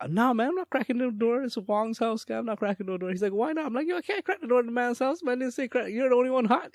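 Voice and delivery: high-pitched mock protest. Re-enacts protesting about the door.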